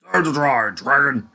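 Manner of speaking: loud villain taunt; menacing taunt. Acted. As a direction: gloat over the downed dragon. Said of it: "The Minotaur laughed".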